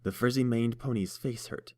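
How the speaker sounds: usual speaking voice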